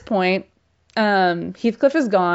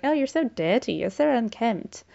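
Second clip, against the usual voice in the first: higher-pitched snooty lady's teasing; haughty mocking; 4 semitones higher, 4 dB quieter than usual. Catherine making fun of Heathcliff, haughty and mocking. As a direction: put on a ladylike, arrogant sneer. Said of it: "she makes fun of Heathcliff".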